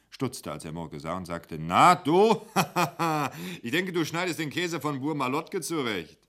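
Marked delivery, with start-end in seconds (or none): 1.6-6.2 high, loud, laughing mate; lachend, hoch und laut; laughing greeting. Acted. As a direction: tease him with a laugh.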